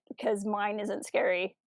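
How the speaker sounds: normal talking voice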